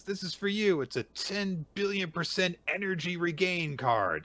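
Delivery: high, loud child imitation; excited kid voice. Small son presenting a homemade gift, high and excited. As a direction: announce it like a proud little kid.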